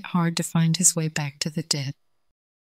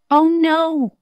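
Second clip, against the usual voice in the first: sheepish; 11 semitones higher, 6 dB louder than usual. Acted. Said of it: "said the little dog sheepishly".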